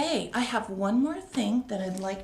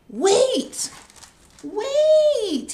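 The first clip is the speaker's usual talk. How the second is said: high, loud rising plea; urgent wait; 13 semitones higher, 8 dB louder than usual. Acted. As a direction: stop everyone, he's upset. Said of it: "he says".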